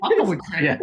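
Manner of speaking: real laughter breaking in; laughing. Real, not acted. Actor laughing at his own quoted movie line.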